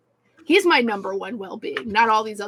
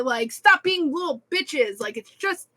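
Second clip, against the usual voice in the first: higher, louder mock furious venting; inner rant; 7 semitones higher, 4 dB louder than usual. Store manager venting inside at customers, mock angry. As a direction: spit out the thoughts she hid. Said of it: "And inside, I'm like".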